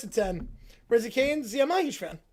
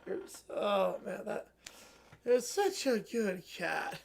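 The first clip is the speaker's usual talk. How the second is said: put-on much softer, fading drunken fondness; sentimental drunk murmur; 4 semitones lower, 7 dB quieter than usual. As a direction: murmur your love, half asleep. Drunk princess gets sentimental.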